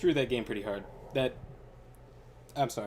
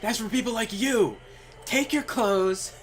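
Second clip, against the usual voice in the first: higher, louder scolding roommate; mock angry roommate; 8 semitones higher, 5 dB louder than usual. Roommate scolding the messy guy, higher and louder. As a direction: chew him out, loud and high. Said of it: "His roommate was like".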